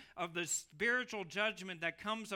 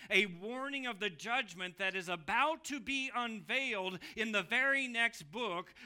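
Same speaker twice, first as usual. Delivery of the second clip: ordinary talk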